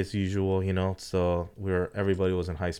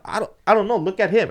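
high, louder, flustered retelling; helpless exasperation; 12 semitones higher, 6 dB louder than usual. Retold or imitated. Driver and Ed stuck over the car, flustered. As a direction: pass the question off in a raised pitch.

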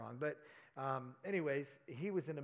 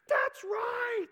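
high, loud excited shout, imitating her; 12 dB louder than usual. Woman hearing her husband's problem, excited shout. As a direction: blurt it out, thrilled.

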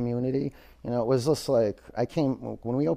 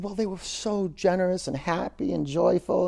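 higher, bright and glowing; cheerful traveler; 7 semitones higher than usual. Acted. Happy traveler tells the wise man, bright and higher. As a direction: gush about the lovely people.

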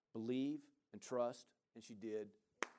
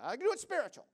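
put-on much higher, louder voice of the woman in her accent; imitating the woman; 12 semitones higher, 7 dB louder, faster than usual. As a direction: lift into her high, earnest voice. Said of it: "she said".

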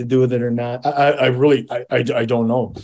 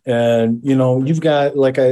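ordinary talk

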